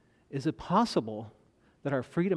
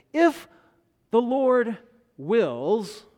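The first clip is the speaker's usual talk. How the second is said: high, loud, slow on "If the Lord wills"; preacher stressing the right way to plan; emphatic; 7 semitones higher, 7 dB louder, much slower than usual. Acted. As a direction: lift and stretch "If the Lord wills" like a call to heed. Preacher lifts the key phrase.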